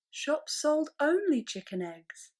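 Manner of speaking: ordinary delivery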